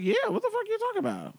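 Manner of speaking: very high, fast retort; mock indignation. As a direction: protest quickly in a high voice. Retold indignant answer to accusation.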